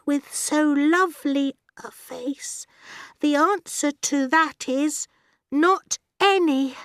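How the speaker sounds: put-on high girl's voice, halting and slow; timid schoolgirl reciting her verse slowly; slow, nervous recitation. A narrator's reading. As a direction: recite nervously with pauses between phrases. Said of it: "very slowly, very nervously".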